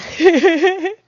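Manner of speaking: high, loud giggling; loud rattling laugh; real giggle. Real, not acted. Laughs at own reaction.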